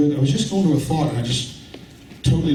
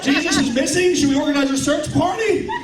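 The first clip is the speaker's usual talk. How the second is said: mock alarm; 10 semitones higher than usual. Mock alarm at missing Jesus.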